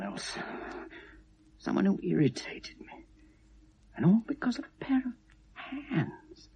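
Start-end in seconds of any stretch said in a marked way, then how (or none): 1.6-6.5 whisper. Acted. Confession into the tape.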